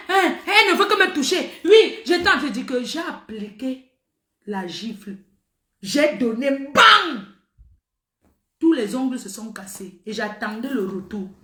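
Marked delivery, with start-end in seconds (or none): 6.5-7.2 slap sound. Retold or imitated. Describing the slap.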